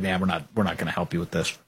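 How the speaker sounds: dropped low, soft and fast like a shrugging senator; mock dismissive mutter. Senators brushing off the host's request, low dismissive mumble. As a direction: toss it off low and quick as if it's nothing. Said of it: "establishment Senate Republicans who are like".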